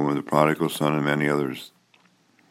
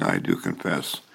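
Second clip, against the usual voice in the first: quiet prompted response; 7 semitones lower, 6 dB quieter than usual. Shown as a demonstration. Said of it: "Then declare so by saying".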